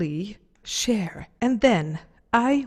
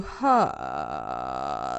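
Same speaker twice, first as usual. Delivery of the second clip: much lower, slow creaky fry on ha; vocal fry. Shown as a demonstration. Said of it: "A little bit of vocal fry".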